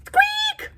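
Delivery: tiny high squeak; high mouse squeak. Acted.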